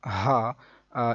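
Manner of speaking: ہا drawn out slow from the throat. Shown as a demonstration. Teacher contrasts a second ha sound, slow drawn ہا. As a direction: let ہا linger, slowly. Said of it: "اس کا مخرج اور ہے".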